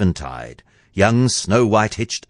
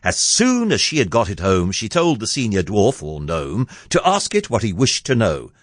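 usual speaking voice

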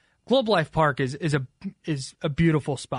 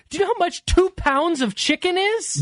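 incredulous; 11 semitones higher than usual. Real, not acted. Incredulous at two-pound chicken tender.

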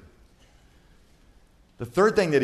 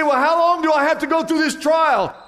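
high, loud whiny question from a weary believer; mock congregant's plea; 12 semitones higher, 7 dB louder than usual. Preacher voicing a weary believer asking God, high and loud. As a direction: play the tired believer begging for an end, pitched up and loud. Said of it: "You say".

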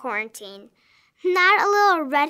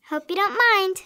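ordinary talk

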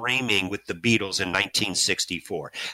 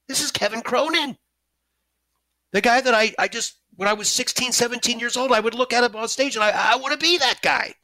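much higher, breathless fanboy thrill; starstruck squeal; 11 semitones higher than usual. Retold or imitated. The fan meets his teenage idol, starstruck and much higher. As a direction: gush like a teenage fan seeing his hero.

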